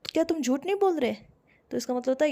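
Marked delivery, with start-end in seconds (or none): 0.1-1.1 higher, pressing accusation; accusing question. Shown as a demonstration. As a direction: ask it like you caught them lying. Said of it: "बोलते ना अपन".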